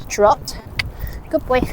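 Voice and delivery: ordinary talk, nothing marked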